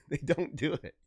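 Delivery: laughing. Real, not acted. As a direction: crack up while insisting. Cracks up repeating the claim.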